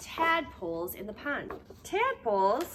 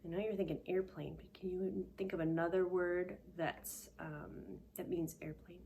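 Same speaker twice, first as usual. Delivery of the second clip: usual speaking voice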